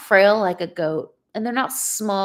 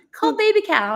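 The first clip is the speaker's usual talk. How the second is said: high mock-prompting voice; retold request; 12 semitones higher, 5 dB louder than usual. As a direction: echo the crowd's request up high. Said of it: "how you call your cow".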